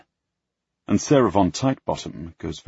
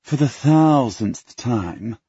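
grumbling; 6 semitones higher, 5 dB louder, much slower than usual. Acted. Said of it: "Penelope grumbles".

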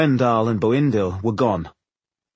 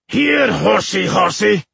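loud, high taunting call; mocking shout; 9 semitones higher, 5 dB louder, slower than usual. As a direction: taunt the horse loudly. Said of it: "shouted Boindil".

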